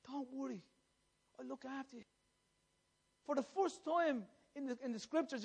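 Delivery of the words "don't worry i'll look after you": soft, hushed plea; pleading. Retold or imitated. Preacher voices Christ pleading with the people, soft plea. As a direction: plead gently, much softer. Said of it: "He was pleading with them".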